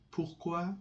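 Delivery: ordinary talk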